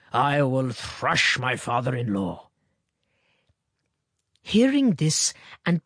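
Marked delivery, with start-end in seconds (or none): 0.1-2.5 mock threat. A narrator's reading. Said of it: "shaking his fist at an invisible policeman".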